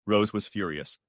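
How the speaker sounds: ordinary delivery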